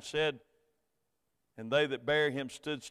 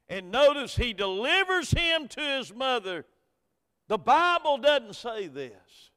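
loud, high preaching climb; full-force preaching; 9 semitones higher, 8 dB louder than usual. Acted. Pastor preaching hope at a funeral, loud and high. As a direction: preach it out to the congregation.

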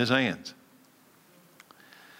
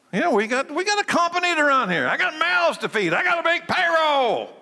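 mock greedy businessman; 13 semitones higher than usual. Mocking the greedy servant's excuses.

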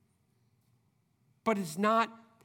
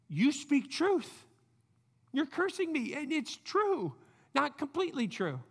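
stunned David; 6 semitones higher than usual. Retold or imitated. David voiced processing the curse.

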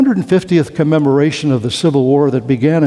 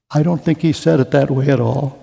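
normal talking voice

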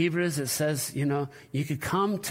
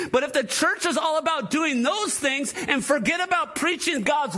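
full-force preaching; 7 semitones higher than usual. Acted. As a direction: preach it hard to the room. Pastor builds into full preaching.

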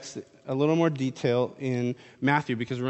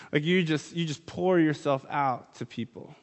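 ordinary talk

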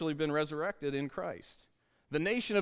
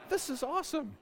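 imitating a happy kid; 9 semitones higher than usual. Son delighted.

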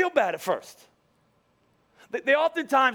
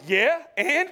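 higher, laughing mock defiance; 5 semitones higher than usual. Retelling his cocky reply to the coach, laughing. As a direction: toss it off with a laugh. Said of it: "And I was like".

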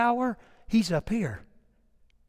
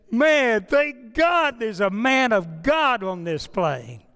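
louder, higher relieved cry, slowed on the thanks; retold shout; 4 semitones higher, 5 dB louder, slower than usual. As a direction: call it out with relief. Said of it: "started shouting".

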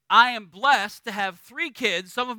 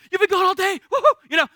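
much higher, bouncy voice of an overjoyed dog; excited puppy; 10 semitones higher, 6 dB louder, much faster than usual. Retold or imitated. Pastor voicing an excited puppy greeting its owner, high and gushing. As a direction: gush like a puppy whose owner just walked in. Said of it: "They're like".

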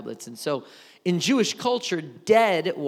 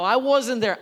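ordinary delivery

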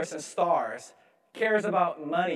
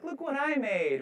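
put-on excited kid; 8 semitones higher than usual.

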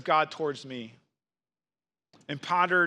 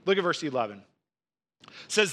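ordinary talk, nothing marked

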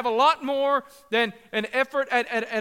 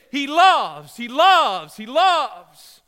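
louder, shouting each repeat of He loves; shouted proclamation; 9 dB louder, much slower than usual. Shown as a demonstration. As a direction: proclaim it as if you want to jump up and scream. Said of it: "scream it".

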